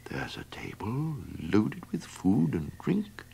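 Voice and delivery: soft hushed whisper. Acted. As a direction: whisper it under the window. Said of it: "whispered the donkey".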